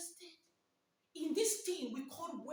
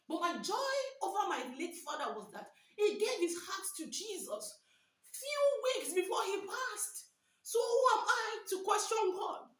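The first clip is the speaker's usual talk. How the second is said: higher and louder, building in joy; joyful preaching; 4 semitones higher, 5 dB louder than usual. Acted. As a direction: rejoice as you proclaim it.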